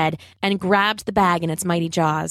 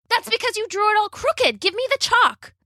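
high-pitched, urgent scolding; acted exasperation, pitched way up; exasperated scolding; 14 semitones higher than usual. A narrator's reading. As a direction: scold him in a much higher voice. Bella scolding Rob amid danger.

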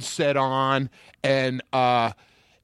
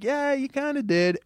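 mild mock agreement; 12 semitones higher than usual. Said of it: "And I was like".

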